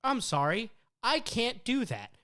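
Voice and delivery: high, loud mock-apologetic refusal; imitated AI, pitched well up; Gemini assistant voice. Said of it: "And Gemini went".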